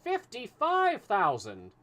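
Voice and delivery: slow, high, loud emphasis; weighty reveal. A narrator's reading. Fix stuns Passepartout with the sum, slow and loud. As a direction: let the sum land hard.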